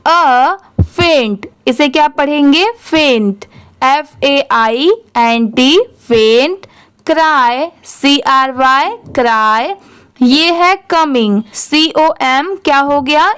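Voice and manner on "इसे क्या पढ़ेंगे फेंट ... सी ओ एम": usual speaking voice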